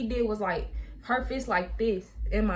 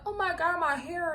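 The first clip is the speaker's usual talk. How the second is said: imitated crying; 8 semitones higher than usual. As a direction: re-enact the tearful lament, pitched way up. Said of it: "So I'm crying, like, I'm like".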